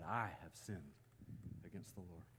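low, soft admission; humble confession. Retold or imitated. Preacher recalls David admitting he messed up, humbly hushed. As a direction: let it fall away almost to nothing.

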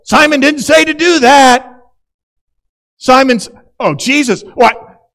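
high, loud rule keepers' outrage; scandalised shout. Retold or imitated. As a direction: splutter like a shocked legalist. Religious people outraged outside.

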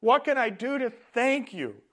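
bursting with gratitude; overjoyed. A narrator's reading. Healed leper eagerly asks Jesus how to repay, overjoyed. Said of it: "shouted the man".

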